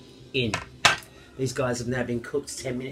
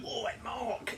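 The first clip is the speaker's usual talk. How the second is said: high mock street call of his name; calling out to Mark; 11 semitones higher, 7 dB quieter than usual. A passer-by calling out to Mark in the street, pitched high. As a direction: pretend you've spotted him across the street. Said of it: "or just go".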